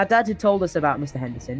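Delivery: ordinary talk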